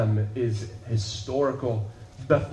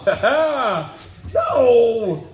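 ordinary talk, nothing marked

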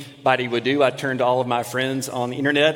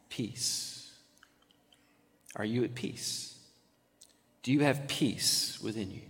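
reflective sermon; 4 semitones lower, 6 dB quieter than usual. Acted. Preacher to congregation, hushed reflective appeal. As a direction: draw them in quietly.

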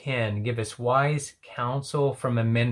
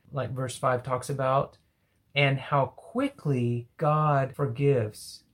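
ordinary talk

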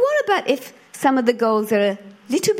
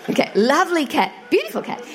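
higher, mildly hammed-up sweetness; mock-sweet correction; 7 semitones higher, faster than usual. Backtracking to God about the stupid cat, mock sweet. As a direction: correct yourself with exaggerated fondness, rising high.

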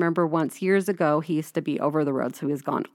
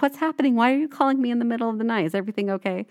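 higher, faster, retelling a panicked husband; mock panic; 6 semitones higher, faster than usual. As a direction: play the woken husband's alarm, hurried. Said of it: "of course he's panicking".

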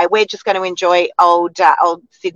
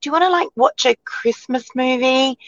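higher-pitched eager question; excited invitation; 6 semitones higher than usual. Retold or imitated. Mum inviting her fifteen-year-old to a movie, excited. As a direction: pitch it up, hopeful, asking the kid. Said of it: "I was like".

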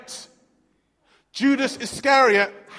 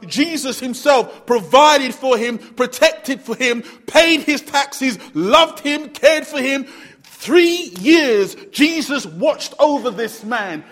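louder and louder, slow, pounding each phrase; preaching at full force; 4 semitones higher, 6 dB louder, slower than usual. Acted. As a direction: build it up like a preacher driving it home.

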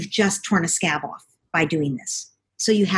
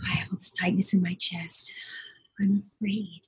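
soft breathy whisper to yourself; whispered inner thought; 6 dB quieter than usual. Shown as a demonstration. Parent quietly naming her own fear in a whisper. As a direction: whisper the feeling as you notice it. Parent noticing feelings in body.

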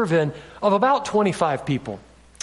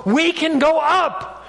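high, drawn-out call to the people; Caleb's rallying cry; 8 semitones higher, slower than usual. Acted. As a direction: urge the crowd on, stretching the words. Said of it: "you've got Caleb over here going".

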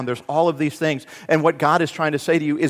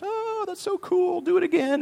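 awed crowd. Acted. Carnival crowd begging the python wrestler for more, awed. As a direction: play the thrilled onlookers, pitched way up.

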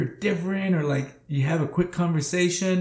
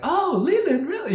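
mock surprise. Retold surprised reply.

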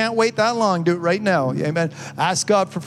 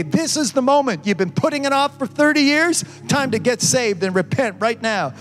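booming motivational speech; 5 semitones higher than usual. Acted. Urgent altar call to repent.

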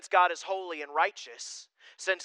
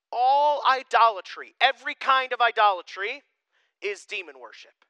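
forceful scripture reading; 5 semitones higher, 7 dB louder than usual. Acted.

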